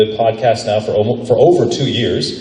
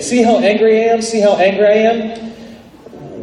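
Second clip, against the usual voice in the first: high-pitched, showy put-on anger; demonstrated anger; 9 semitones higher than usual. Shown as a demonstration. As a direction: put up an angry front, voice pushed high. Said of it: "trying to put up a front and say".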